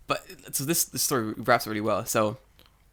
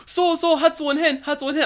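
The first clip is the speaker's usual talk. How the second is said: high, louder mock kids; clamoring class; 6 dB louder than usual. Whole class chiming in about pronunciation, high imitated voices. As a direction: imitate pupils piping up in Japanese. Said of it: "everyone's in the class like".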